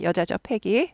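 usual speaking voice

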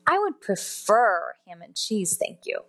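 "prefer" stretched out with a strong second syllable; "prefer" drawn out and emphasized; stress on PREFER. Shown as a demonstration. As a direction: lean hard on "prefer", slowing on it. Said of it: "use this example to emphasize certain words".

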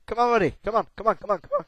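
high, loud urging that eases off; frantic come on chant, fading; excited urging. Real, not acted. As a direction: cheer the rider along. Urging character on.

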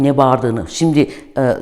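ordinary talk, nothing marked

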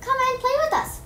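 high, bright call to a friend. Acted. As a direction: call them over to play. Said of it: "they would call".

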